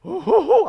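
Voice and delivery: high, loud spooky "ohoho"; maiden ghost laugh. Acted. Preacher imitating a bleeding maiden ghost, spooky laugh. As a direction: hoot like a hammed-up lady ghost. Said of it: "처녀 귀신".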